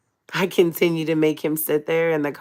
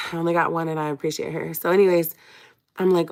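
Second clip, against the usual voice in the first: ordinary delivery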